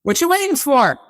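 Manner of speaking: lightly voiced off-camera questioner; mock curious caller. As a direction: call it up to the dock from afar. Off-camera voice in commercial.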